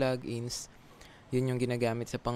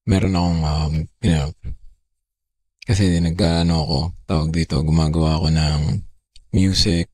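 pitch-shifted, much louder, lower processed voice; voice changer effect; 4 semitones lower, 15 dB louder than usual. Real, not acted. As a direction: talk through a voice changer set lower and loud. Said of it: "ito yung normal boses ko".

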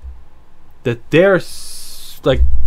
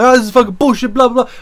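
mock yelling; 5 semitones higher, 3 dB louder, faster than usual. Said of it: "hear her yelling".

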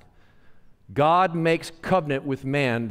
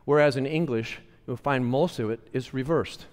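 ordinary delivery

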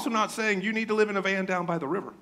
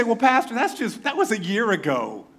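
mock-innocent excuse, higher; defensive congregant; 6 semitones higher, 4 dB louder than usual. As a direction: play the churchgoer brushing it off. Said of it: "You say".

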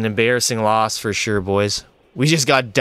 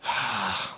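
long quiet deflated sigh; weary sigh; 3 semitones lower, 12 dB quieter than usual. Real, not acted. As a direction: let the air out in defeat. After missed chance.

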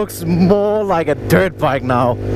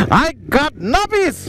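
higher, gleeful repeat; excited; 7 semitones higher than usual. Real, not acted. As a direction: brag it with delight.

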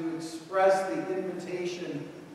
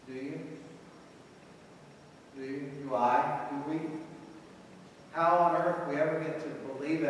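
ordinary talk, nothing marked

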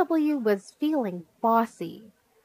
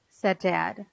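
ordinary delivery, nothing marked

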